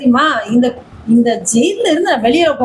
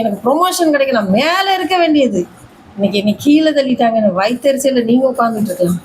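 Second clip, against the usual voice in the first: usual speaking voice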